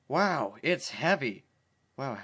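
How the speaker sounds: slow, put-on cartoon pony line; lightly voiced pony. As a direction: read it as the pony, slowly.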